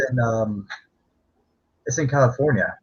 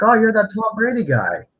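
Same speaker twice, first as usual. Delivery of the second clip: fans recognizing him; 11 semitones higher than usual. Retold or imitated. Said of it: "It's like".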